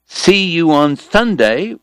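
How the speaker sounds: "See you on Sunday" slow, each S crisp; careful S sounds. Acted. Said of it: "confident enough to call".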